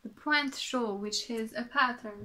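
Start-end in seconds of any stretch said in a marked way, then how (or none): none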